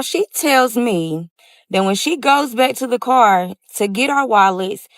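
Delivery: normal talking voice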